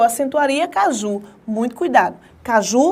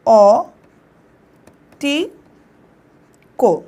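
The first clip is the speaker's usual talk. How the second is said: syllable drill; 3 dB louder, slower than usual. Shown as a demonstration. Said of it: "Separa pra mim".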